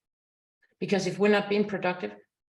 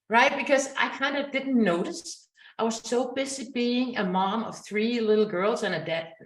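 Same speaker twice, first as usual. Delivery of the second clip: ordinary talk, nothing marked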